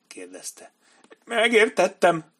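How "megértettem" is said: high, slow, loud despairing reply. A narrator's reading. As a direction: give in with despair. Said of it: "mondta elkeseredetten Benny Singh".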